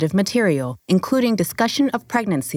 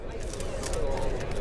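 weary sigh; 5 semitones lower, 12 dB quieter than usual. Acted. Host aside off camera.

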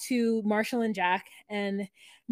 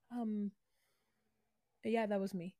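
much softer, hesitant imitation; sheepish admission; 10 dB quieter than usual. Rival confessing to the angry boyfriend, mock-sheepish and quiet. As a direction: own up quietly, after a pause.

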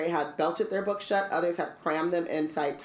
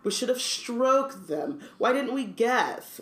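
mock sneer; 7 semitones higher, slower than usual. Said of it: "Malfoy sneered".

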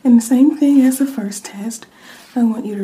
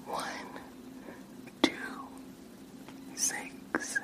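faint far-off whisper into one ear; distant test whisper; soft whisper; 21 dB quieter, slower than usual. Shown as a demonstration. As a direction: breathe each word softly into the ear. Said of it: "me whispering in your ears like the last one".

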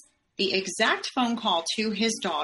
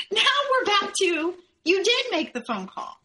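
high, incredulous laugh breaking through the words; laughing in disbelief; 11 semitones higher than usual. Real, not acted. On the phone with her husband, laughing in disbelief. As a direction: laugh at how absurd his new version is. Husband's story flips yet again.

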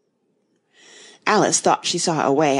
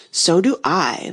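ordinary delivery

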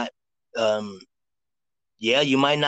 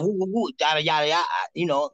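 higher-pitched playful nonsense patter; New York street patter; 5 semitones higher than usual. Shown as a demonstration. Reader shows Brooklyn caller how Harlem talks, New York street patter. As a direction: rattle off New York filler syllables. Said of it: "That's how we talk".

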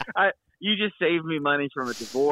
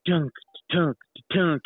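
higher-pitched thunks, spaced out; imitated clanking; 4 semitones higher than usual. Man recalling scent bottle in the dryer, imitated clanking. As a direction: mimic a bottle knocking round a dryer. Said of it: "I could hear it clanking".

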